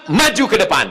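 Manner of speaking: usual speaking voice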